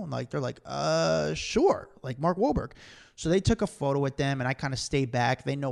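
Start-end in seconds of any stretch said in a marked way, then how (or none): 0.6-1.9 puzzled, pitched-up yes; hesitant acceptance. Retold or imitated. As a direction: imitate fans agreeing uncertainly. Acts out fans' hesitant answer.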